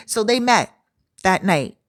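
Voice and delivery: usual speaking voice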